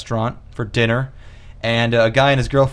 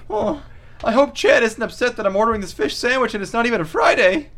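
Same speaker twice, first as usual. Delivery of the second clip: high, anxious mock-meek voice; timid diner; 11 semitones higher than usual. Bullied diner worrying about Chad's approval, timid and high. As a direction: fret in a high put-on voice.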